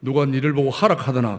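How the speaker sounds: a hint of a stern voice from above; lightly voiced rebuke, low and firm; voice of God. God rebuking the complaining elder, lightly stern voice. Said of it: "하나님의 음성이 들립니다".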